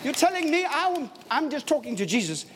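the praying mama can't believe what she hears; mock surprise, high and wide-eyed; astonished mama. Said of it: "The mama was saying".

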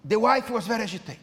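ordinary delivery

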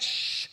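hushing "shh"; drawn-out shushing sound. Acted. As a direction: shush someone who is crying out. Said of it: "Those who had said".

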